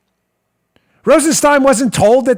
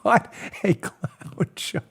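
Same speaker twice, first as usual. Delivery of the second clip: whispered scorn; 8 dB quieter, much slower than usual. Real, not acted. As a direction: hiss it under your breath. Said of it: "disgusted aside".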